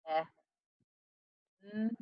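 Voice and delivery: eh and n said as separate sounds after b, with long gaps; sounding out Ben. Shown as a demonstration. Teacher segments Ben sound by sound for students. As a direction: stretch out each sound of Ben apart. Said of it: "I would go b".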